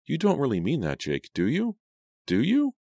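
high-pitched, anxious questioning; anxious pleading. A narrator's reading. Girl begging Jake for an answer, anxious and high.

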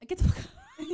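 shouting through laughter; laughing shout. Acted. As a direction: yell it while cracking up.

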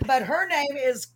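normal talking voice

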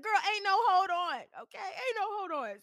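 loud, high, laughing through the words; laughing retort. Retold or imitated. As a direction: laugh it off while refusing. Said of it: "I said".

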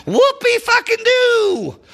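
high, slow sarcastic sneer. Real, not acted. Fan scoffing at rival's game, high slow sarcasm. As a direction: drag it out in mock cheer.

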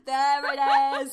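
loud drawn-out cheer; triumphant crowing. Real, not acted. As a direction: crow it in delight, stretching each word. Triumphant on hearing the accent appear.

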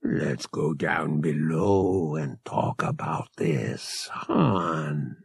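low, slow, coaxing; low and soothing. A narrator's reading. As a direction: talk him down gently, slowly. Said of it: "his voice low and soothing".

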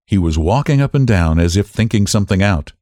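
usual speaking voice

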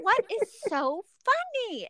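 high-pitched mock indignation; indignant. Retelling her reply to Ramel's laughing, high indignant voice. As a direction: stretch it out high, put out at being laughed at.

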